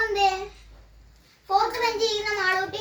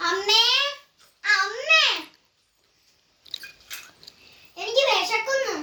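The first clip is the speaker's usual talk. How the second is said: calling mom, a touch whiny; hungry child calling; 3 semitones higher than usual. Acted. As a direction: whine for mom from the next room. Child calling her mother.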